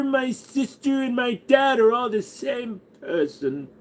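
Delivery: lightly voiced pompous noble, high and slow; hammed-up royal voice; mock inbred royal. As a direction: boast like a dim aristocrat. Continues the royal character bit.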